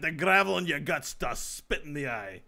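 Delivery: loud, gruff mock Scottish threat; Scottish accent. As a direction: growl it like a hammy Scottish brawler. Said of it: "your Scottish always".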